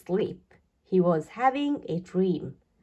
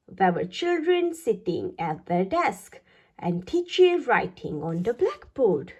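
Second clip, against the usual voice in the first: usual speaking voice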